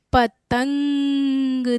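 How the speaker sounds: पतंग very slowly, long nasal ng on तं; nasal anusvar demo. Shown as a demonstration. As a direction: stretch पतंग and let तं ring in the nose. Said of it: "ये कैसे उच्चारित हो रहा है".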